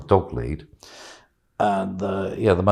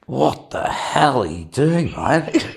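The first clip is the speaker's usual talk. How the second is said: slow, shocked question, half laughing; 5 semitones higher, much slower than usual. Retold or imitated. The shocked friend seeing him on a lead, aghast. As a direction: gasp at the sight of the friend. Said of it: "she's like".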